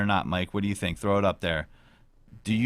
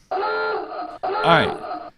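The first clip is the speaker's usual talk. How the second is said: high loud burst, all right; sudden shout; 5 dB louder than usual. Real, not acted. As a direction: yelp it out suddenly.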